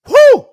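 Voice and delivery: loud high celebratory woo; whoop. Real, not acted. After a drink, loud high celebratory whoop. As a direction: let out a big whoop after the shot.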